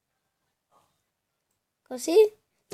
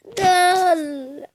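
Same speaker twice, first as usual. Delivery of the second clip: excited shout; 5 semitones higher, 6 dB louder than usual. Real, not acted. Scoring in game.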